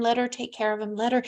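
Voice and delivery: ordinary delivery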